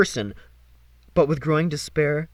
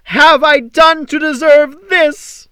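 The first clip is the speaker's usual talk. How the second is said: high, loud, slow anguished wail; wailing to the sky; 13 semitones higher, 12 dB louder, slower than usual. Acted. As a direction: howl it at the heavens. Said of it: "wailed to the cruel sky".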